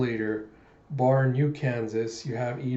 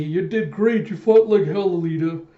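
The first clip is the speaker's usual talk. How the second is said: raised, loud, higher praise; rousing shout; 5 semitones higher, 7 dB louder than usual. Retold or imitated. Jaskun shouts praise to fallen Alita, loud and high. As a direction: bellow it like a proud warrior.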